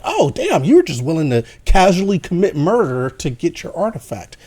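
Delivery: high, loud burst easing into disbelief; mock shock. Reviewer reacting to the heroine's casual murder, mock shocked.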